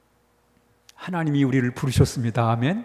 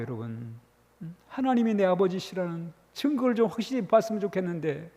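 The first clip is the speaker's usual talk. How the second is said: ordinary delivery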